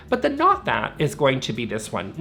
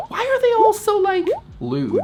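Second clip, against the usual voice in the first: much higher, squealing disbelief at the gnomes; incredulous squeal; 4 dB louder than usual. Real, not acted. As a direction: shriek it like you can't believe it. Outraged at lewd garden gnomes.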